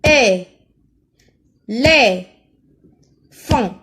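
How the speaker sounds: E, Les, Font as isolated syllables, long pauses between. Shown as a demonstration. Teacher has pupils listen for é, isolated syllables E, Les, Font. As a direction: say E... Les... Font one loud syllable at a time. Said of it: "Écoutez".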